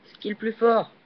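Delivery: high, loud playful gloating; gleeful loud brag, pitched high; triumphant boast. Real, not acted. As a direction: crow it like the winner. Boasts after a successful move.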